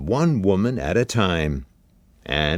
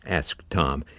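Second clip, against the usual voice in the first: usual speaking voice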